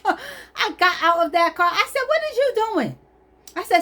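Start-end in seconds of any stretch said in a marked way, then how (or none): 0.0-0.2 high startled yelp with a laugh; laughing, high-pitched shock; retold startled cry. Startled as he opens car door. 2.1-3.0 very high, incredulous demand; retold shock, pitched way up; acted outraged disbelief. Confronting the man at car.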